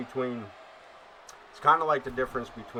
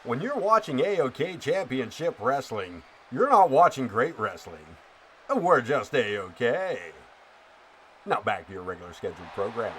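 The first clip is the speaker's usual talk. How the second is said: radio announcer voice; 4 dB louder than usual. Shown as a demonstration. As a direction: punch it like a cheesy wrestling radio spot, stretching "A-okay". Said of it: "keep going with that radio voice".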